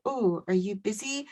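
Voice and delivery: soft, low, slow imitation of doubters; mock condescension. Co-host imitates people doubting her work, mock condescension. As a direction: ask it quietly with feigned interest. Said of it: "certain people say".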